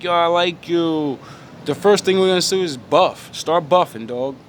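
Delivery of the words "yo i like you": mock pickup line.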